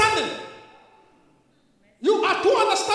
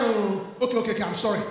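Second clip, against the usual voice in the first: lower-voiced, hurried backing down; mock placating apology; 8 semitones lower than usual. Husband giving in once the woman cries, mock apology. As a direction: imitate a husband caving in to tears. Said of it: "as soon as the woman start crying".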